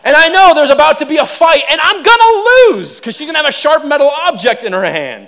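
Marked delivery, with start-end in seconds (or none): 0.0-3.0 higher, louder, comic panic; mock dread. Telling the church about the tweezers, hammed-up panic. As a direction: build to a hammed-up wail of doom.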